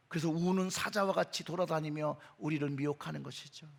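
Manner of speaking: usual speaking voice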